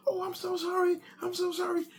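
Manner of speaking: very high flustered apology; embarrassed wife. Retold or imitated. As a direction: squeak out the sorry like the wife. Wife apologizes, wrong van.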